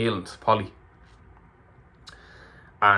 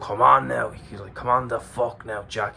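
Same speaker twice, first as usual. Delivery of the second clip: put-on a light Bray accent, shouted encouragement; hint of a Bray accent urging him on; thick Bray accent. Passing runner urging Jack on, in a light Bray accent. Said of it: "he's a thick Bray accent".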